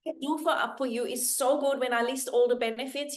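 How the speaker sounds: ordinary delivery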